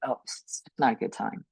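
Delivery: soft, half-whispered brush-off; muttered excuse. Acted. Person putting off a needed vacation, muttered excuse. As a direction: talk yourself out of it under your breath. Voicing one's excuse to skip rest.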